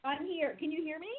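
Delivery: muffled and far off, like a tunnel; distant, boxy Bluetooth headset mic sound. Real, not acted. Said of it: "you sound like you're in a tunnel".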